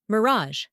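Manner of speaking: "Mirage" ending in a soft zh; soft French ending. Shown as a demonstration. Teacher giving another French loan word, soft zh ending. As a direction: stress the second syllable of "Mirage", soft zh at the end. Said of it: "the end of the word is more softer".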